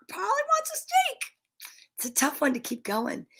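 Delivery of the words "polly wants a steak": very high, soft squawking parrot. Acted. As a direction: repeat it like a parrot. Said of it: "I get to do a parrot voice".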